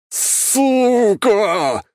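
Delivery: furious high roar; roaring like a wild beast. A narrator's reading. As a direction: roar it out in rage. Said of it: "диким зверем взревел куратор".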